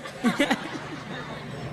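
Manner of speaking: soft unvoiced chuckle; breathy laugh. Real, not acted. Preacher chuckling softly at the crowd's answer. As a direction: let out a quiet airy laugh. Laugh after audience confusion.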